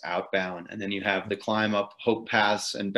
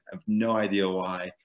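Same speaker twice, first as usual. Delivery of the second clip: ordinary talk, nothing marked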